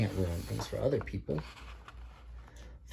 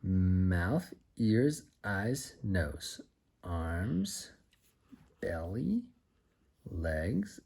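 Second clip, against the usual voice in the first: ordinary delivery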